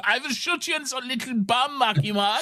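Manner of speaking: high-pitched cartoon German; high, silly threat in German accent. Soldier threatening to shoot [name], hammed-up German accent. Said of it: "Like, a stereotypical one".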